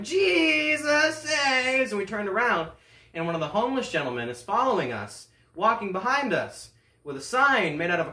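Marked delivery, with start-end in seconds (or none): 0.0-1.9 slow stretched high yell; long high mock preacher yell; preacher scream. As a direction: drag out Jesus saves like the street preacher. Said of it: "for a third time".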